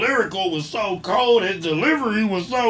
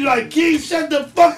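loud, higher playful shout; yelling; 9 semitones higher, 4 dB louder, slower than usual. Real, not acted. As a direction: yell it at your friend. Friends yelling during banter.

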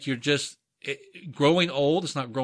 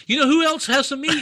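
high, loud teasing question; 13 semitones higher, 6 dB louder than usual. Acted. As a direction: pitch it way up to tease. Teasing setup, jumps high.

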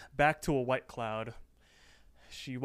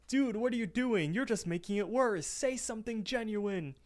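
a higher, lightly put-on Rigby, urging his buddy; lightly voiced Rigby; 9 semitones higher than usual. Rigby urges Mordecai during the storm, lightly higher voice. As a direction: nudge him to be genuine in a light Rigby voice. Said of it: "And Rigby says".

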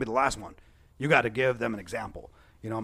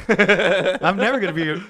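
slow words through laughter; laughing; 6 semitones higher, 9 dB louder, slower than usual. Real, not acted. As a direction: laugh while you say it. Laughing while speaking.

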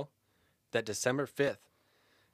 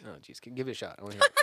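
normal talking voice

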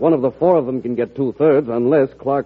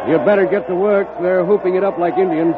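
higher, louder call over a cheering hall; raised over the noise; 9 semitones higher, 4 dB louder than usual. Acted. Governor calls to aides over parading delegates, raised voice. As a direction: push it over the din.

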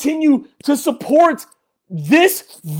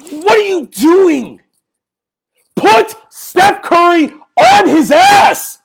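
slow, loud and high demand; angry yell; 5 semitones higher, 3 dB louder, slower than usual. Real, not acted. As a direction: yell it at the players. Fan yelling at team.

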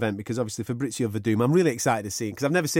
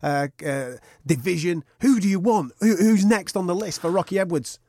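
higher, pushy interviewer; playing Dan Hardy; 5 semitones higher than usual. Interviewer hounding the fighter, rising to a higher push. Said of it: "I'll be Dan".

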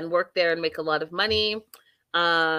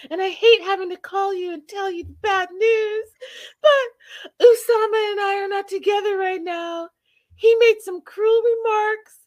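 very high, hammed-up distress; Debbie impression; 13 semitones higher, 5 dB louder than usual. Acting out a mother giving bad news, high wailing voice. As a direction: build to a high, wailing complaint. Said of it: "she's like".

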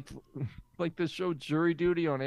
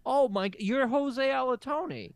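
shocked realisation; 10 semitones higher, 3 dB louder than usual. Acted. Sudden reveal of secret identity.

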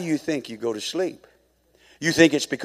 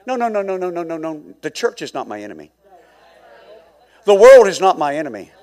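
normal talking voice

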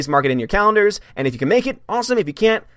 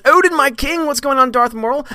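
high, louder regal voice; mock royal homage; 11 semitones higher, 4 dB louder than usual. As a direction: hail the king grandly. Chat message read grandly.

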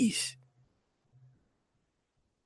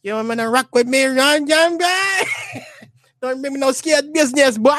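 very high, hammed-up Jamaican threat; Jamaican accent. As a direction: squeal a mock Jamaican challenge. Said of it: "talking that Jamaican accent".

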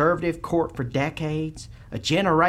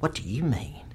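whispered question; 4 dB quieter than usual. Acted.

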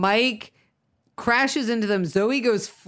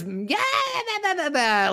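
higher, stretched-out mock babble; mock chatter; 11 semitones higher than usual. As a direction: imitate someone who won't stop yapping. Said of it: "And of course she starts".

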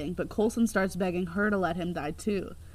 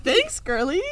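teasing May voice; 7 semitones higher, 7 dB louder than usual. Retold or imitated. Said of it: "And she's like".

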